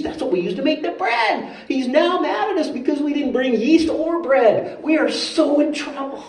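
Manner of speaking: high-pitched comic panic; mock freaked out. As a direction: fret in a high, hammed-up voice. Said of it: "the disciples are really freaked out".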